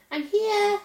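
put-on a girl shouting to the others that she's back; shouted. A narrator's reading. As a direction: call out to the others down the passage. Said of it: "she shouted".

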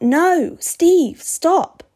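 high, loud cry of alarm. A narrator's reading. Narrator wanting to stop his friend, crying out high. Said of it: "leap to my feet and cry out".

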